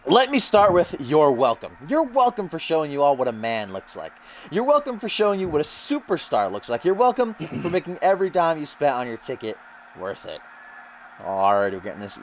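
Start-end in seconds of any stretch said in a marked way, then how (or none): 0.1-10.4 higher-pitched, cocky wrestler promo voice; Russell Pontarelli. Acted. Commentator voices the newcomer's promo for listeners, cocky wrestler voice. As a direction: play a self-important wrestler addressing his crowd. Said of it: "Russell says".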